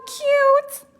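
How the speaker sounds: squeaky high cutesy creature voice; cute finger monkey. Retold or imitated. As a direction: coo like a tiny excitable pet. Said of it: "imagine the cutest little excitable tiny little thing".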